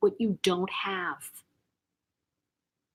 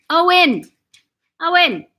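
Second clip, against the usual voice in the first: loud, higher call of a name; 7 semitones higher, 8 dB louder than usual. Real, not acted. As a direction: call out firmly to interrupt someone. Calls Owen off mid-chat.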